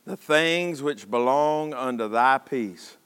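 slow, measured reading with long pauses; solemn scripture reading. Real, not acted. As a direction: let each phrase of the verse stand alone. Reads scripture aloud.

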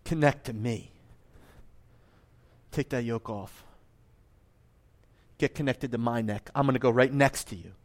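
soft, low, unhurried appeal with long pauses; tender invitation. Acted. Preacher voices Jesus inviting the burdened to him, tender invitation. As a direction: offer it gently, as close reassurance.

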